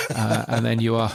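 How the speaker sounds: ordinary talk, nothing marked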